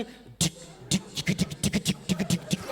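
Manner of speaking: soft whispered ticking like a drum machine; mock DJ beat ticks. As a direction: tick out a beat under the breath. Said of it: "DJ बाज सी".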